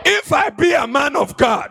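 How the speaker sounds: loud, high declaration; preaching at full force. Acted. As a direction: proclaim it with power.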